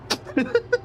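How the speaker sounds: squeaky burst of laughter; high-pitched giggle at own slip. Real, not acted.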